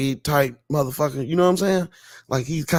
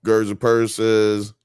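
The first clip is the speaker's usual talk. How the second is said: ordinary talk, nothing marked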